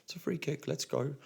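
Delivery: put-on reassuring child. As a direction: soothe the young player, no big deal. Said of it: "Just be like".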